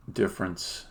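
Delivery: ordinary delivery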